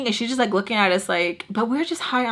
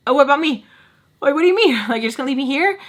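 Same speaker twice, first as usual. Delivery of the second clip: indignant protest; 4 semitones higher, much faster than usual. Retold or imitated. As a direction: protest being abandoned, pitch jumping up. Being left behind, protesting.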